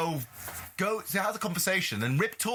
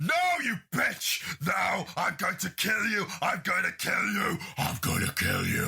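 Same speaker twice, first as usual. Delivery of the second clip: put-on possessed child threatening; 4 semitones higher than usual. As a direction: start high, sink into a mock demon. Possessed child threatening mother, acted.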